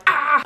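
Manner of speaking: loud, high frustrated "ah"; pent-up outburst. Real, not acted. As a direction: vent it in one loud cry. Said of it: "big ball of energy right now".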